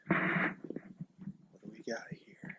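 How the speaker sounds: hushed hunter whisper. Real, not acted. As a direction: whisper so the deer can't hear. Whispering over a deer track.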